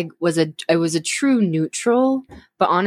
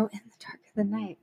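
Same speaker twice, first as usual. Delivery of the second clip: whisper; 3 semitones higher, 12 dB quieter than usual. Pretend. Whispered repeat of the title.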